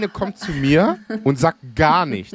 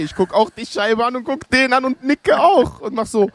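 animated retelling; 12 semitones higher than usual. Real, not acted. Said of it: "genau das Gleiche".

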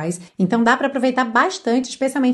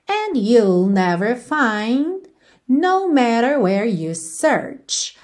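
slow demonstration reading; much slower than usual. Shown as a demonstration.

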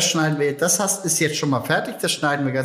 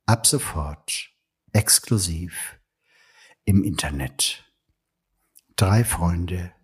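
deep, slow movie trailer voice; ominous trailer narrator, slow and low; horror trailer voice; 7 semitones lower than usual. Shown as a demonstration. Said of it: "mit der Trailerstimme sprechen". (tiefe, langsame Horror-Trailerstimme)

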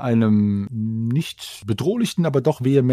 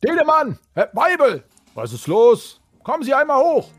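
calling out; 11 semitones higher than usual. Acted. GM voices guard calling up.

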